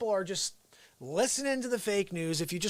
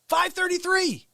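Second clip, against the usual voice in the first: high, slow; 10 semitones higher than usual. Real, not acted. Repeating count again.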